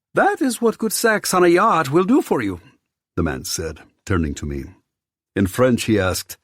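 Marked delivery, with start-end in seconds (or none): 0.1-2.6 high, loud, playful host voice; flamboyant host. A narrator's reading. Theo teases newly arrived guest, flamboyant. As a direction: tease with showy delight.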